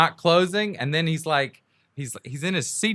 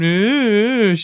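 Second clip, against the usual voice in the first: high mocking "ooh"; imitated jeering "ooh", pitched way up; taunting "ooh"; 9 semitones higher, 4 dB louder than usual. Imitating a driver watching her come round, mocking ooh.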